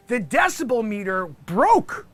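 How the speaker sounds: ordinary talk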